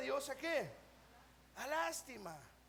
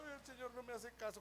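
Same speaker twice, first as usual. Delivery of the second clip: soft, quick mock whine; hammed-up little poor-me moan; mock complaining; 10 dB quieter, faster than usual. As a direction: grumble feebly like a neglected believer. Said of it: "muchos de nosotros".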